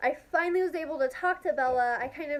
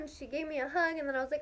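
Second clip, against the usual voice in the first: ordinary talk, nothing marked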